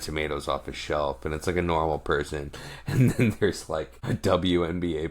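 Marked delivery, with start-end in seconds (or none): none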